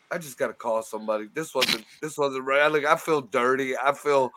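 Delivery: low, soft, ashamed murmur; acted shame, sunk low and hushed; mock traumatized confession. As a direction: confess it quietly, as if violated. Acts out shaken call afterwards.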